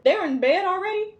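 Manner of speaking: high-pitched disbelieving questions; incredulous. Retold or imitated. Mother hearing kids slept early for nana, incredulous. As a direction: retell it as if you can't believe it. Retold disbelief at nana's bedtime success.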